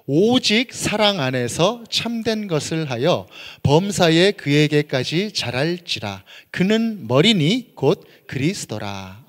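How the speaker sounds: very slow, projected recitation; measured unison-reading voice; congregational scripture reading. Acted. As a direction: read the verse out slowly for all to join. Leads scripture reading aloud.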